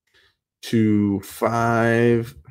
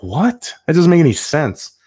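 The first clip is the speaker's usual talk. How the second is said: confused disbelief; 6 semitones higher, 5 dB louder than usual. Retold or imitated. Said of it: "And I was like".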